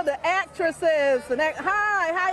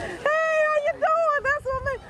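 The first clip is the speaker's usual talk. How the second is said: much higher, sing-song hello; delighted greeting. Real, not acted. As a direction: call out happily to a friend.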